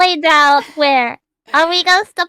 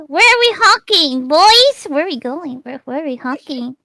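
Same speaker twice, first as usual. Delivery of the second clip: ordinary delivery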